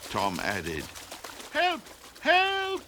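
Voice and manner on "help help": very high, softer squeals; tiny chick calling from afar, fading; distant cries for help. A narrator's reading. Chick crying from far away.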